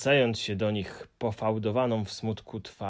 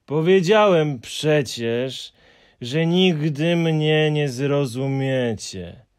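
slow, louder huffy creature voice, not a true mutter; grumbling Hemulen; 4 semitones higher, 7 dB louder, slower than usual. A narrator's reading. Hemulen grumbling at the friends, slow and huffy. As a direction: grumble it stubbornly. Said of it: "mruknął Paszczak".

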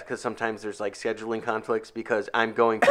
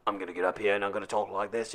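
a lower, softer imitation of Russell Crowe; Russell Crowe impression; 6 semitones lower, 6 dB quieter, faster than usual. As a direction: speak low and soft, the way Russell Crowe talks. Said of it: "It's like".

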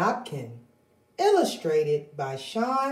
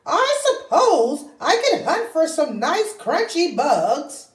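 high, fretful hen voice, tail word squawked; fussing anxious little hen; worried hen; 9 semitones higher than usual. Acted. Henny Penny fretting to Ducky Lucky about the weather, high and fussy.

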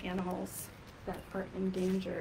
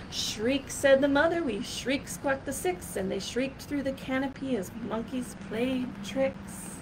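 lively, sing-song picture-book reading; rhythmic, louder read-aloud storytelling voice; reading to children; 4 semitones higher, 5 dB louder than usual. Acted.

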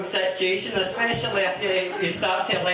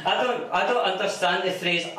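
ordinary delivery

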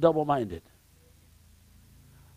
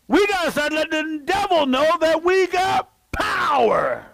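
preaching at full force; 12 semitones higher than usual. Real, not acted. Preacher rousing congregation against devil.

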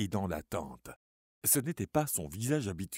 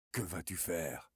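lower, soft, icy question; cold murmur; 4 semitones lower, 6 dB quieter than usual. Acted. Charlie holds Suzanne back and murmurs coldly. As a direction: murmur it coldly while gripping her arm. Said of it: "murmura-t-il froidement".

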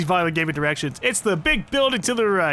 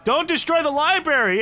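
mock protest cry; 10 semitones higher than usual. Mock-joins the student protest.

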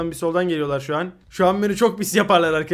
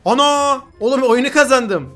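loud, high burst of disbelief; excited shout; 5 semitones higher, 8 dB louder than usual. Real, not acted. Gamer yelling to chat about his sudden win, excited. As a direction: yell it like you just won.

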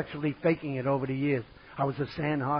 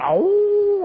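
long, loud, high "ohhh"; mock hoot; 9 dB louder than usual. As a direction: imitate a gang's drawn-out jeering hoot. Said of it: "they come out and they went".

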